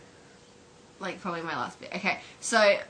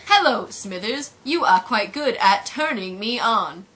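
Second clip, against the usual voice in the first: slow, loud, word-by-word delivery; stilted machine greeting; computer voice; 6 dB louder, slower than usual. Acted. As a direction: recite it like a talking computer. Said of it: "computer, he's just like".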